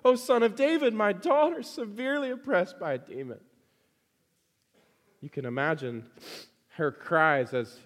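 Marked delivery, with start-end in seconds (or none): 0.0-3.4 acted desperate plea, raised high; crying out. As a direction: call out imploringly as the begging mother. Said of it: "she begins to cry out".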